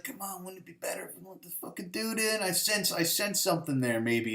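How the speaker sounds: soft, hushed imitation of pleading; coaxing. Disciple convincing her to let him in, softly coaxing. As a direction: quietly talk her round, lightly acted. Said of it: "he has to convince her".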